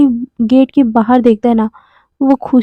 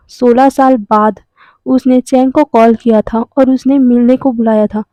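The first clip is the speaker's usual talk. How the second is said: usual speaking voice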